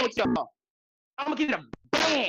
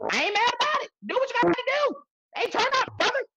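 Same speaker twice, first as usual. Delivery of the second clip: excited; 12 semitones higher than usual. Real, not acted. Cheering boss on.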